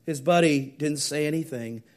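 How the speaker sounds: normal talking voice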